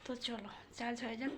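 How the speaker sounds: ordinary delivery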